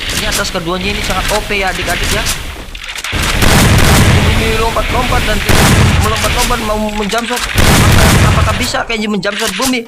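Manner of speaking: put-on lively play-by-play for kids; mock sports commentary. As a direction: call the match like a hyped caster. Live game play-by-play for kids. (komentator pertandingan yang bersemangat)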